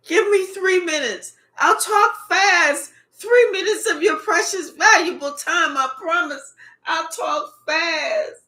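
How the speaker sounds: high-pitched, hammed-up pleading; mock begging. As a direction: imitate someone bargaining desperately for a moment. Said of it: "I'm begging you".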